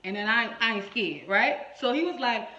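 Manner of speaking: ordinary delivery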